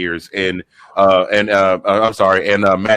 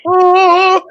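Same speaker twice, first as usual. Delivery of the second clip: excited oh; 6 dB louder than usual. Real, not acted. Excited exclamation.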